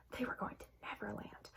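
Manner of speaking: hushed whisper of a secret; secretive whisper. A narrator's reading. Secret shared plan.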